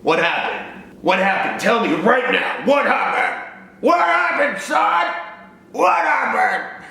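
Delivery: hammed-up frantic father building up; dad demanding answers, growing frantic; mock panicked dad. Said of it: "my dad was like this".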